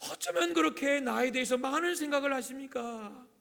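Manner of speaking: loud, high cry to God in wonder; impassioned prayer. Acted. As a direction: build to a marvelling, pleading climax. Climax of the verse as prayer. (벅차게 외치는 기도)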